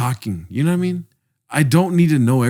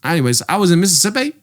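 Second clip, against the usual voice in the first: higher-pitched, nonstop mock Australian chatter; Australian accent; 5 semitones higher than usual. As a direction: ramble on in a bad Australian accent. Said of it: "He would not stop talking".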